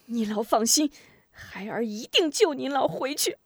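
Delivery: high-pitched, determined vow; determined son's promise; fierce resolve. A narrator's reading. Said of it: "发狠道".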